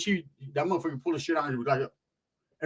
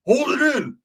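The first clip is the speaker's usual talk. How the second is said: higher, strained words on held breath; holding in smoke; 9 semitones higher, 4 dB louder, slower than usual. Retold or imitated. Smoker showing off how long he holds it, strained held breath. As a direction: imitate talking with lungs full of smoke.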